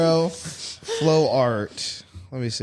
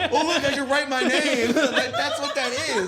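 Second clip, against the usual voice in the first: high laughing tease; 10 semitones higher than usual. Real, not acted. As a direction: tease through giggles. Mocking flow art while laughing.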